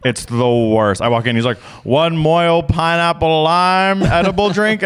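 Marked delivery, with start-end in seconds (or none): 1.8-4.8 mock weed-seller greeting, higher and drawn out; imitated weed guy. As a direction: slowly sing out a regular's order, imitating him. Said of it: "I have a weed guy".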